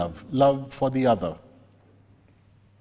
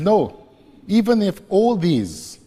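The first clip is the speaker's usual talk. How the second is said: emphatic protest; 6 semitones higher, 5 dB louder than usual. Acted. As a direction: raise the voice higher and louder in protest. Peter protests he won't deny.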